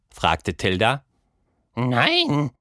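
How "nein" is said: high, falling, slightly miffed no; high creature voice, a touch huffy; almost offended. Acted. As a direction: sound a bit insulted. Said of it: "Die Stimme klang fast beleidigt".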